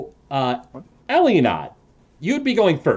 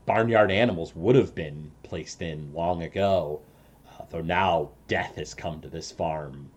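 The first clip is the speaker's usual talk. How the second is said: low, soft scene setting; hushed game master narration, lower and quieter, with suspenseful pauses; 游戏主持人场景描述; 7 semitones lower, 9 dB quieter than usual. Acted. Game master sets the eerie scene.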